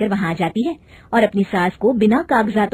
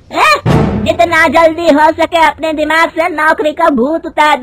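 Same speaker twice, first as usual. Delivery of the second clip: loud, higher, scolding mother-in-law; angry scolding; 5 semitones higher, 6 dB louder than usual. Acted. Mother-in-law scolds daughter-in-law for wanting a job, loud angry. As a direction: berate the daughter-in-law, voice raised. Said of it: "उसकी सास गुस्से में कहती है".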